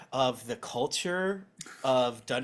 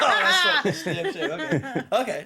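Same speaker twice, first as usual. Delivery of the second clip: laughing; 9 semitones higher, 5 dB louder than usual. Real, not acted. Laughing at own joke.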